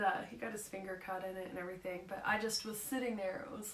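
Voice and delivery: normal talking voice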